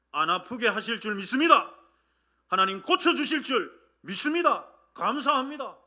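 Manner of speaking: loud, building prayer of faith; fervent prayer. Shown as a demonstration. Believer praying thanks for healing beforehand, fervent and loud. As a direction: declare it loudly, rising with conviction.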